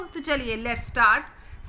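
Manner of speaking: ordinary delivery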